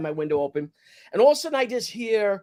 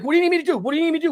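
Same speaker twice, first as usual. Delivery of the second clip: high, pressing repeated question; urgent offer; 8 semitones higher, 4 dB louder, much faster than usual. Retold or imitated. Bystander asking a cop how to help, urgent.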